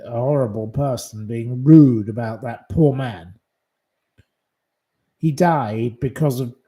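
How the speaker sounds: ordinary talk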